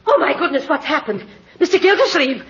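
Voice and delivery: high, flustered cries; high startled alarm. Acted. Secretary finding her boss slumped over the desk, high and alarmed. Secretary finds him asleep.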